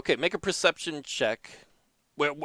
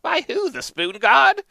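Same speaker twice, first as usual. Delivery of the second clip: put-on higher, practical old wife; lightly voiced old wife; 12 semitones higher, 8 dB louder, slower than usual. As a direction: chime in as a sensible old wife. Gina voice, higher.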